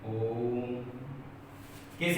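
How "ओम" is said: ओम with the O held long, low and soft; low, soft ओम, stretching the O before the M; drawn-out O. Shown as a demonstration. Teacher giving an example of pluta svara, drawn-out O. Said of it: "ओ को मैंने काफी लंबे समय तक बोला है".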